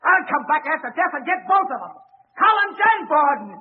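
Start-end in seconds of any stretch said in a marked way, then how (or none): 2.4-3.5 rising, louder defiant shouting; defiant outburst. Acted. Convict defying the courtroom in a rising angry shout. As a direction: hurl it back at the court. Condemned man defies the courtroom.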